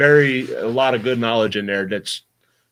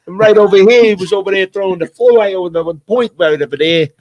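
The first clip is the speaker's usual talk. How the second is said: higher, louder hammed-up Brooklyn accent; 7 semitones higher, 5 dB louder than usual. Guest imitates a Brooklyn narrator for the fishing book, hammed-up Brooklyn accent. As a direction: play a loud Brooklyn guy pointing out the spot. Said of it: "real strong Brooklyn accent".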